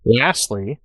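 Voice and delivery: ordinary delivery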